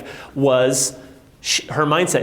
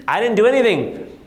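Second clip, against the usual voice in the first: usual speaking voice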